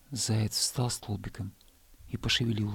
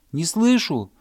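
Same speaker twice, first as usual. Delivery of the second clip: higher, louder shout to be heard; 10 semitones higher, 8 dB louder than usual. Acted. Hare shouting back up to the bear cub. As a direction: yell it up the hill. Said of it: "крикнул заяц".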